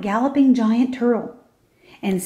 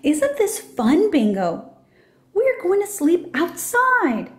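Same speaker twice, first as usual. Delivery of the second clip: put-on high, slow and eager child voice; a little girl thrilled about camping; breathless wonder at sleeping outdoors; excited little girl; 6 semitones higher, slower than usual. A narrator's reading. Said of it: "She's excited to be camping".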